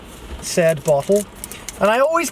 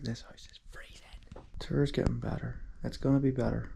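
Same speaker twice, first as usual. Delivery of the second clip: real whisper, much softer, to himself; 4 semitones lower, 13 dB quieter than usual. Real, not acted. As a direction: hush it down to a breathy aside. Quiet remarks in a cold house.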